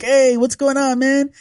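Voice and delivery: high, chummy bro voice. Retold or imitated. Strangers at the bar greeting him, high and chummy. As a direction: pitch it way up like a party guy. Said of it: "they're just like".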